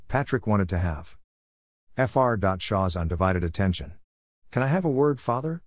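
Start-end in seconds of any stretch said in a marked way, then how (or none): 4.5-5.6 lightly voiced teenager, pitched higher; slightly teenage, higher-pitched request. A narrator's reading. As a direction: ask it with a hint of teenager. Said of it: "felt and sounded like a teenager".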